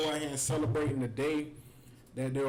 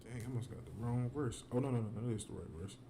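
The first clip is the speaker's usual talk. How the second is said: quiet mutter; 5 semitones lower, 8 dB quieter than usual. Real, not acted. Muttering aside over wrong verse.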